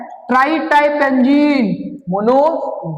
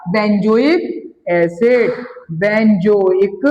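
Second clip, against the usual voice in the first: slow drill; much slower than usual. Shown as a demonstration. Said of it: "teacher drills the name slowly".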